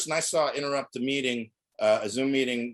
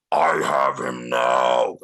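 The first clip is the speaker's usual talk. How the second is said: much lower, slow, loud demonic shout; lower-pitched recording; 12 semitones lower, 8 dB louder, much slower than usual. Pretend. Prank caller plays the Satanic parent grabbing the boy, demonic. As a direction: boom it like a devil taking his prey. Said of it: "my voice recorded at a lower pitch".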